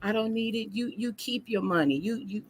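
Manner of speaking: put-on high, soft child voice; lightly voiced sweet little boy. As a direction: grandson gently refusing his grandpa's money, soft and high. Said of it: "And he said".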